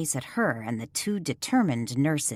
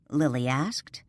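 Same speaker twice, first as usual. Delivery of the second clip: usual speaking voice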